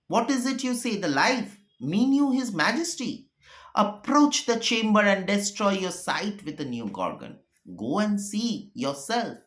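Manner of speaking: the shock of hearing the news; Shakespeare recitation. Acted. Teacher voicing the alarmed exchange to the class, urgent. Teacher reads the scene aloud.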